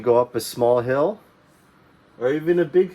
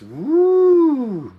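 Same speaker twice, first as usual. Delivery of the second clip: high swooping woo up the mountain; rising woo; 14 semitones higher, 6 dB louder than usual. Shown as a demonstration. Teacher showing a tall mountain to kids, soaring woo. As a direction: sweep the voice up high like a peak.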